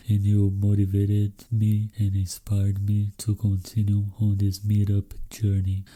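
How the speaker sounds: ordinary delivery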